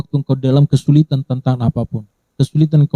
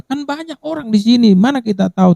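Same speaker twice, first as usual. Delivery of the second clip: much higher, incredulous objection; mock disciples' protest; 11 semitones higher than usual. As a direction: play the disciples, doubtful and pushy. Disciples voiced objecting to Jesus.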